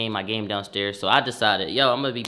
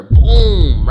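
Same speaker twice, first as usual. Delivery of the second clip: loud falling boom; 4 semitones higher, 15 dB louder than usual. Retold or imitated. TV crashing onto grandma, loud boom. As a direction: slam out the crash.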